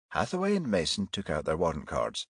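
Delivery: plain reading voice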